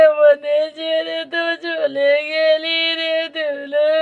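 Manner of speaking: slow drawn-out keening for Dulu; old woman's lament. Acted. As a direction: wail slowly over a lost daughter. Grandmother laments her dead daughter. (মেয়ের জন্য ধীরে টেনে টেনে বিলাপ)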